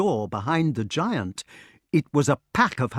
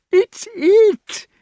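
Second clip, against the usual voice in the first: very high, loud, gleeful shout; shouted in high delight; 10 dB louder than usual. A narrator's reading. King hearing his beast coming, very high delighted shout. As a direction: yelp with joy. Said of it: "he shouted in high delight".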